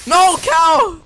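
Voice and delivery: loud high cry of dismay; dismayed shout. Real, not acted. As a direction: cry out in horror. Cow burned by accident.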